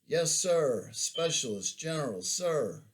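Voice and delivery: a loud, eager mock private sounding off; loud mocking yes-sir voice; Gomer Pyle. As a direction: ham up a dim, eager recruit saluting. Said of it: "I mocked him with my best Gomer Pyle salute".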